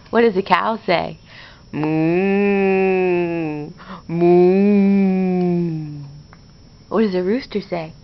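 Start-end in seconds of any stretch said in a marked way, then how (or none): 1.7-6.0 cow moo. Shown as a demonstration. Showing baby what cow says.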